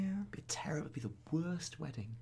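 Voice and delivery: hushed unvoiced whisper. Real, not acted. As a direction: say it under breath. Whispered joke.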